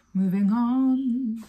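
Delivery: high, slow drawn-out announcement; high slow mock-dramatic moving on. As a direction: stretch it out like a big announcement. Dramatic move on after sad scene.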